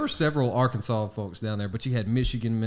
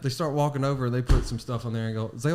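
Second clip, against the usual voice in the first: usual speaking voice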